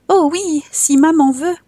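put-on high, bright little boy's voice; small child voice. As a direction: answer like an innocent little boy. Child Jean answers gendarme.

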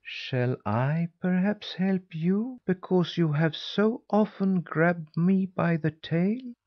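high, drawn-out feline mockery; purring, satisfied cat. A narrator's reading. Cat replying to the boy who grabbed its tail, smug purring. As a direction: ask it slowly and silkily, pitched high. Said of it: "purred with satisfaction before he replied".

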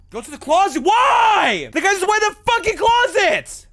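high, loud frightened yelling; panicked shout. Real, not acted. Player spotting the man by the closet, panicked yell. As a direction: scream it in panic.

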